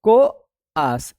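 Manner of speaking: कोआर्स drawn out slowly as "ko-aars"; slow model pronunciation. Shown as a demonstration. Teacher slowly modelling कोआर्स for the class. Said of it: "इस तरीके से इसका pronunciation होता है".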